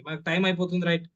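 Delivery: normal talking voice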